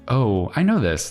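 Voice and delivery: usual speaking voice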